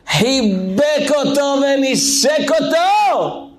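preacher swelling on the embrace; emphatic. A narrator's reading. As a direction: proclaim the embrace with full emotion. Emotional climax of the story.

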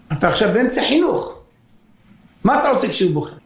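ordinary talk, nothing marked

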